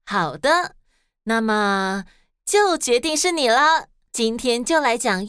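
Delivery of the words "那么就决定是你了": ordinary delivery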